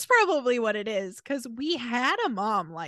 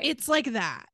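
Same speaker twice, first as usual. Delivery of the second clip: normal talking voice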